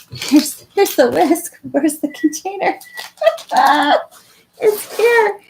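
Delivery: high, loud and laughing; bubbling over while searching; giddy excitement. Real, not acted. Hunting for container, laughing.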